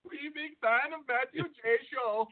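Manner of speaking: hammed-up Indian caller voice; Indian accent. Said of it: "Indian voice though".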